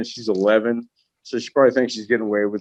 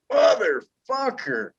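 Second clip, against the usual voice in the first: put-on much higher, slow sleepy toddler voice; imitating a waking toddler; 11 semitones higher, slower than usual. Dad mimics daughter waking up, high and slow. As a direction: drag it out like a stretching little girl. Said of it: "she goes".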